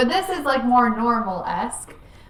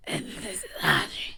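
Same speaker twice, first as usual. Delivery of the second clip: whispered voice-train intro; 4 dB quieter than usual. Pretend. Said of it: "I'll voice train them".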